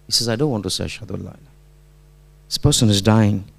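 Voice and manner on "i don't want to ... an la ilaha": low, much softer, falling away; retold weak refusal. Dying student refusing his teacher's prompt, quiet refusal.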